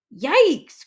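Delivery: loud, high yelp of alarm; startled cry. A narrator's reading. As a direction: jump back with a yikes. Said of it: "cried Joey Mackerel".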